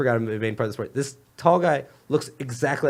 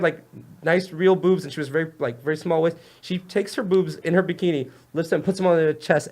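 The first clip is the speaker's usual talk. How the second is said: ordinary delivery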